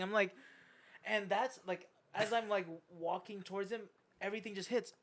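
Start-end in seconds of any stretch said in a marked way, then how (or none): none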